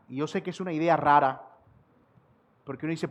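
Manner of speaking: normal talking voice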